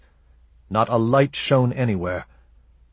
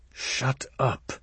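hushed order; 2 semitones higher, 7 dB quieter than usual. Acted. Raven silences Shed before the being.

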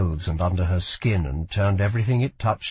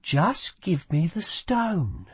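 coaxing; 7 semitones higher, slower than usual. A narrator's reading. Lila coaxing the stone away.

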